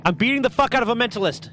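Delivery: excited fast. Real, not acted. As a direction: brag mid-fight, fired up. Fighting monster excitedly.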